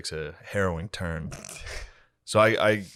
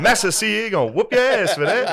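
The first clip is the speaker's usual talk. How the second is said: reenactor voice; 13 semitones higher, 8 dB louder than usual. Retold or imitated. Imagining the reenactor scolding them, high and laughing. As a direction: bark it out in a hammed-up high voice. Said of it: "he would've been like".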